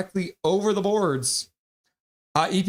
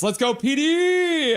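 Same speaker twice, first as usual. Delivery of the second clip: loud drawn-out rally cry, pitched way up; hype shout; 11 semitones higher, 4 dB louder, slower than usual. Acted. Reading a chat message to the stream, shouting it slowly. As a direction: belt it slowly like a chant from the stands.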